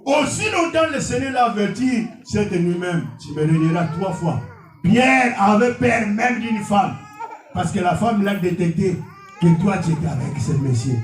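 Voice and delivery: ordinary talk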